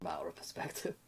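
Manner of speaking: dry whispered aside. Acted. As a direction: whisper it under your breath. Player's muttered aside.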